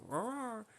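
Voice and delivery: high squealed imitation of a toy dog's roar; a quick high mock roar, like a cartoon dog alarm. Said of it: "it sounds like a di-, a dying dog".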